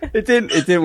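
words breaking up with giggles; real giggly confession; laughing admission. Real, not acted. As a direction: admit defeat through laughter. Admitting failure while laughing.

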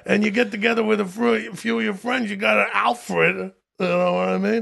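Long Island regular giving his opinion; Long Island accent. Acted. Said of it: "that's a Long Island accent".

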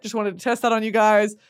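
usual speaking voice

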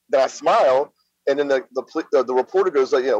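ordinary delivery

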